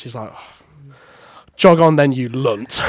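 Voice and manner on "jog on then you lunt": angry dad. Retold or imitated. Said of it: "my dad's furious".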